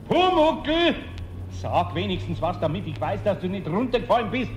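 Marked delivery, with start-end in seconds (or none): 0.1-0.9 calling out. Acted. Eder calls out of window.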